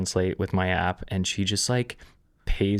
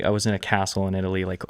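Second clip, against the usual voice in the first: normal talking voice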